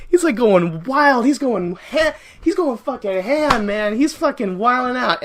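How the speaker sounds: loud, high, drawn-out key words; worked-up, amazed storytelling; wildly excited. Real, not acted. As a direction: rave about it at full volume. Excitedly retells wild scene.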